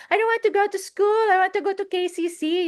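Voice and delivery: put-on high, whiny little-girl voice; child's voice. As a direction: whine it like a stubborn child. Girl quoted refusing school.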